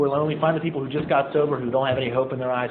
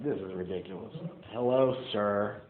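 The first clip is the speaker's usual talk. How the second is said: lower, softer, awkward "hello, sir"; sheepish prayer; 5 semitones lower, 5 dB quieter than usual. Retold or imitated. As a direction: mumble an embarrassed greeting to God. Awkward self-mocking prayer.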